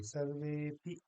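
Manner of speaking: सर्वेपि with वे drawn out; सर्वेपि as one lengthened word; joined long e. Shown as a demonstration. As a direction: stretch the वे in सर्वेपि. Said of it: "कहेंगे".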